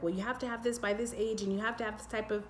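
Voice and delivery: fast mock list of society's rules; rushed demands. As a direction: rattle off the demands hurriedly. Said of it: "very much the way society functions".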